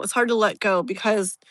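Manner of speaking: usual speaking voice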